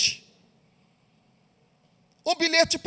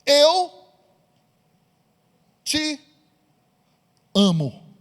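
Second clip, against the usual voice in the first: long pauses between each word; a slow, suspenseful reading of a love note; slow dramatic reveal; 3 dB louder, slower than usual. Acted.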